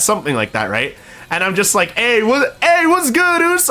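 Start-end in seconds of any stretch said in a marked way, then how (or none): 1.9-3.7 hyped greeting. Retold or imitated. Streamer greeting a friend on stream, hyped and high. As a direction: call out to a friend with big energy.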